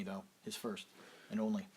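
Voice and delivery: ordinary delivery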